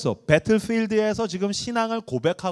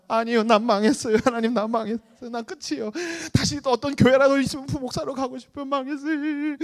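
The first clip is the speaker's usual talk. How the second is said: higher, whiny lament; mock despair; 8 semitones higher than usual. Pastor replaying his next-day despair, half-comic lament. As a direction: wail about being finished.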